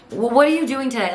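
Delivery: high, loud retold challenge; mock confrontational. Ryan confronts the complaining old lady, mock confrontational. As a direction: push back at a complainer, acted out.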